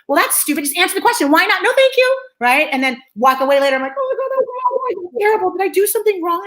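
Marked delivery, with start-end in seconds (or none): 0.1-1.6 exasperated pushback. Retold or imitated. Plays people pushing back on refusal. 1.6-2.2 very high, chirpy mock politeness; high-pitched refusal. Showing her only answer at first, high sing-out refusal. 4.0-6.4 high, mock-anguished worry; guilty fretting. Walking away and doubting herself, high mock guilt. Plays guilty panic after refusing.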